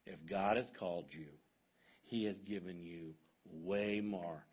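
measured low preacher voice with weighty pauses; Doctor Rogers. Retold or imitated. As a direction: drop way down into a grave, fatherly baritone. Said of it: "in his deep baritone voice".

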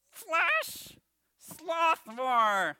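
high, loud puppet outburst; Bones. Pretend. Puppet finishing the verse wrongly, high loud voice. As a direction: puppet blurting a wrong guess. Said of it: "I'm your ventriloquist".

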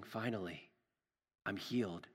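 soft, low inner thought; quiet relief. Acted. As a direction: let it out softly, as a private thought. Healed woman's private thought, voiced softly.

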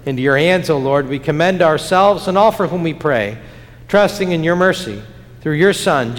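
normal talking voice

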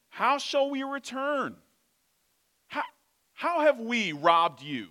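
put-on high, loud whiny teen; whiny teenager voice. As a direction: whine it like an entitled preteen. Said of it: "whiny, petulant ... teenager voice".

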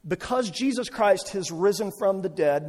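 ordinary talk

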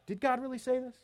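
high, soft questioning; sowing doubt. Acted. Satan questions God's word to the woman, doubting. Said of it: "So he puts this doubt".

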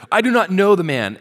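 swearing denial. A narrator's reading. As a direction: swear it out loud, punching "not". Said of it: "began to invoke a curse on himself and to swear".

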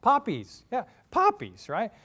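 high, loud witch voice; Wicked Witch voice. Acted. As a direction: relish it like a scheming witch. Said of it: "What does she say?".

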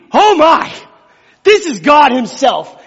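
loud, high gasp of awe; awestruck terror. Acted. Isaiah seeing God in the temple, loud awestruck cry. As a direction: cry out trembling before God. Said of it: "shaking knees".

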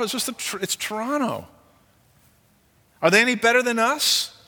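haughty mayor boasting, voice pitched high; mock proud mayor. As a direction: play the smug mayor puffing up his city. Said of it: "maybe he says to them".

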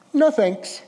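put-on high-pitched Arabic-accented grandfather; bad Arabic accent. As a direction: wave it off in a mock Arabic accent. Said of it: "forgive my bad Arabic accent here".

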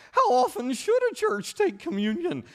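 mock a fussy questioner in a high voice; Needleman voice. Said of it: "in their favorite Needleman accent".

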